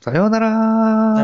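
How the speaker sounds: drawn-out goodbye. Pretend.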